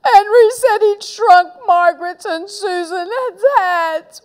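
high, loud tearful whine; whimpering. A narrator's reading. Little brother telling mum on Henry, high whimper. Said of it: "whimpered Peter".

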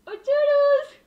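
very high, very loud shriek; shrieked exclamation. Real, not acted. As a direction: belt it out like a sudden scream. Exclamation at end. (grito agudísimo y muy fuerte)

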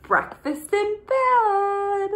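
high, slow, drawn out fanfare; ta-da, present the surprise; proud announcement. Acted. Snail announces surprise breakfast.

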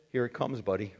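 usual speaking voice

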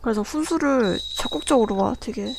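normal talking voice

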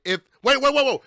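ordinary delivery

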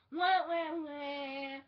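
mock wah wah crying; hammed-up fake sob. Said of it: "Just kidding".

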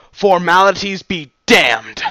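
slow, loud and high defiant cry; loud exclamation. Acted. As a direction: spit it out with full force. Narrator's character exclaims in anger.

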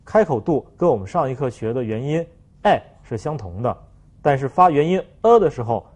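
ordinary delivery

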